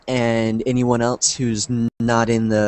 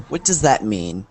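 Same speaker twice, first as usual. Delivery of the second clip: normal talking voice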